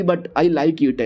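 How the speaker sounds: very slow, dragged-out but I like it; drawn-out deadpan quip. Acted. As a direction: savour each word like a punchline. Bodyguard's deadpan twist.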